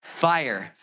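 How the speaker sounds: normal talking voice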